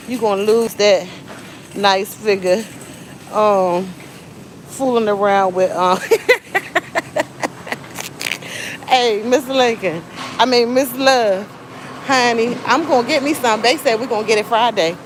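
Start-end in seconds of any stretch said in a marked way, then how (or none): 6.0-8.8 breathy laugh. Real, not acted. Laughing mid-sentence.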